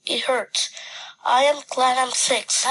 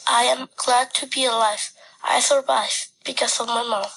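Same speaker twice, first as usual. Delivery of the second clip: ordinary delivery, nothing marked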